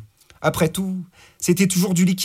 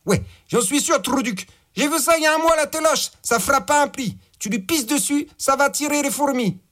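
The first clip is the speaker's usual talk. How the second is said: higher, brash gangster boss; 9 semitones higher than usual. Acted. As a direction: bark orders like a crude crime boss. Boss gives orders by phone.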